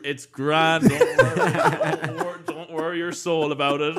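put-on loud, slow drunk reassurance through laughter; cracking up while imitating a hammered friend; laughing imitation.

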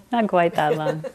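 chuckling through the correction; laughing at a slip. Real, not acted. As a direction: laugh it off lightly.

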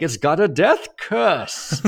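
high, slow ominous warning; Crazy Ralph. Retold or imitated. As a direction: croak out the doom slowly. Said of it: "my Crazy Ralph impression".

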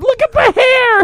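very high excited squeal; wife showing off the hair, squealing; giddy squeal. Retold or imitated. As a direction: squeal it like a delighted kid. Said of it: "she's, like, holding up".

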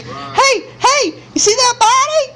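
high-pitched mock boast; acted squeal of someone claiming their fame; excited bragging. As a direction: call out proudly, putting on a thrilled voice. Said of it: "someone somewhere is like".

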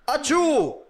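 mock agreement. Real, not acted. Mocking reaction in banter.

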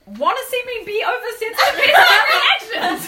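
high mock-angry challenge bursting into a shout; mock outburst. Reader answering Edward for calling her oversensitive, mock outburst. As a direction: playfully blow up, loud and laughing.

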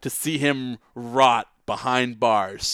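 slow, loud courtroom plea; booming lawyer oratory. Pretend. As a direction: thunder it at the jury slowly.

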